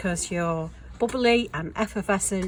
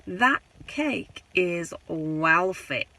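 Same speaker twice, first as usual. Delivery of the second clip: slow, lightly put-on Manchester accent; Manc accent; much slower than usual. Doing a best Manc accent, slow and deliberate. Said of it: "That's my best man accent".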